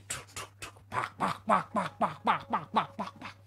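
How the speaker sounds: soft, rhythmic chop noises; imitated chopping. Storyteller mimics cutting the next tree, soft rhythmic chops. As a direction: tap out quick quiet axe blows with the voice.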